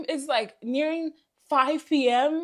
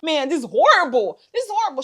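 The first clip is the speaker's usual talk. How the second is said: appalled outburst; 3 semitones higher, 6 dB louder than usual. Retold or imitated. Customer looking at her botched hair, appalled outburst. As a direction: exclaim it, pitch leaping up on the repeat.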